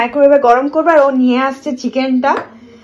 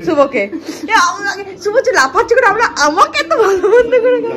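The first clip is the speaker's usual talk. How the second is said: laughing through the words, voice pitched up; 7 semitones higher than usual. Real, not acted. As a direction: talk through real laughter. Laughing while thanking.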